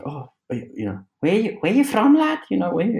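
high, questioning Scouse lilt; Scouse accent. Retold or imitated. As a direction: mimic a Liverpool pub local's Scouse. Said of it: "in his proper Scouse accent".

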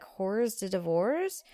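"Horrors de Divorce" slowly sounded out, rising; HORS D'OEUVRES misread. Retold or imitated. Hostess asking the bartender, "Horrors de Divorce" hesitantly. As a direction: read "Horrors de Divorce" as spelt, unsure. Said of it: "I'm like".

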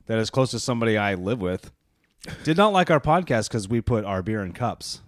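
normal talking voice

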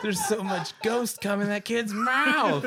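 high, loud, drawn-out cry; hammed-up wail. Podcaster riffs on a haunting film, hammed-up laughing wail. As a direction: wail it slowly through laughter.

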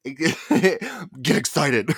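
loud, drawn-out demon cheer; weird demon voice. Real, not acted. Host hyping up listeners, slipping into a weird demon voice. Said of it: "that was weird demon voice".

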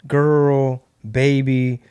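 ordinary talk, nothing marked

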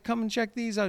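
high-pitched imitation of a dad; dad's voice. Dad calls his kid over to the shoes, high imitated voice. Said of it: "He's like".

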